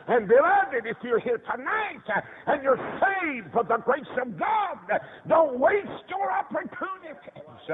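high, urgent exhortation; impassioned preaching. Real, not acted. Preacher urging the saved not to waste opportunities, impassioned. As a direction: plead at the top of the range.

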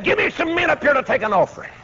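high, fast commanding call; barked order. Retold or imitated. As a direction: call out a brisk high-pitched demand. Ordering the crowd after silencing it.

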